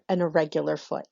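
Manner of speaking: normal talking voice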